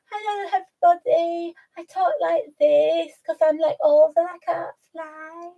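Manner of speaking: put-on high, lisping old-animal voice; mock high pet voice; Alfie voice. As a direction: speak as a comical elderly pet. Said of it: "So he was like".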